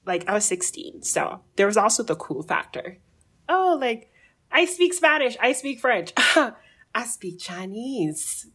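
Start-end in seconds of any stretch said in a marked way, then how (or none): 3.5-8.5 put-on high, playful teenage boast; mock showing off. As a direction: show off your languages, tongue in cheek. Teen showing off the cool factor.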